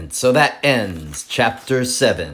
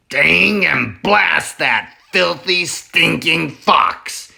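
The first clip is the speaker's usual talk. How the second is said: higher, louder cranky farmer voice, stinking fox slow; grumpy farmer Boggis; 6 semitones higher, 5 dB louder than usual. Acted. Boggis cursing the fox, angry farmer voice. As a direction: spit out the curse like a sour old farmer. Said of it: "he said".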